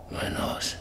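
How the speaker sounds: a whispered claim to his own house; whispered, tender. Acted. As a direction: hold the words close, almost silent. Old man clinging to his house.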